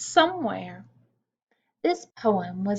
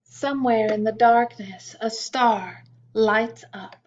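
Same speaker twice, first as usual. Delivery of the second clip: put-on reading a poem to children. A narrator's reading.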